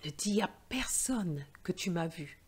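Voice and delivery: hushed conspiratorial whisper; secret whisper. Acted. Santa asking the girl to keep their meeting secret, whispered.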